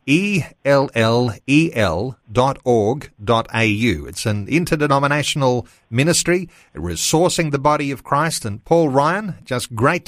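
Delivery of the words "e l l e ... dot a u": slow, deliberate letter-by-letter spelling; each letter given its own beat. Real, not acted. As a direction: spell out the web address so it can be noted. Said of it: "Just note the spelling there".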